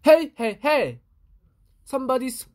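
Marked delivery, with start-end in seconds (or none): 0.0-1.0 higher, loud hey hey; loud protest. Acted. Louis protesting at the reader's mess. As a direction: call out to stop them.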